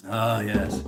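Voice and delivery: ordinary talk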